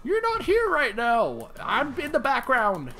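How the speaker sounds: squeaky high bear scolding. Pretend. Bear scolding the streamer, squeaky and high. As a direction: tell him off in the high bear voice.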